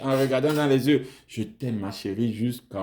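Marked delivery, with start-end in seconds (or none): 1.3-2.8 tender. Pretend. Husband whispering love to wife.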